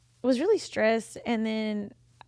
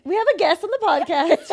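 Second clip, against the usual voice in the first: loud, higher, laughing announcement; 7 semitones higher, 6 dB louder than usual. Real, not acted. Host deflecting emotional moment with a joke, laughing. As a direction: joke blurted through laughter. Joking after getting emotional on air.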